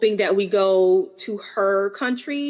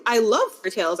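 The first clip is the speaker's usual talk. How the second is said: ordinary talk, nothing marked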